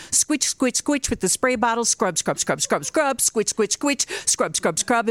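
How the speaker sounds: rhythmic squitch and scrub sounds; scrubbing noises. Retold or imitated.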